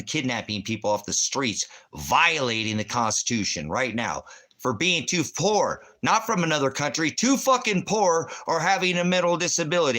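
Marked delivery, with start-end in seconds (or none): 4.6-10.0 furious shouting. Real, not acted. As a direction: pitch jumps high and loud, yelling in outrage. Heated rant about the Constitution.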